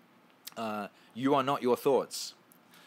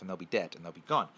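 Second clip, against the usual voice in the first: ordinary talk, nothing marked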